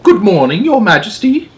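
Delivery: high, loud voice of an oldish maid; a respectful old servant greeting the Queen; maid's voice. Acted. Maid greets the Queen good morning, respectful high voice. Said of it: "a woman was saying".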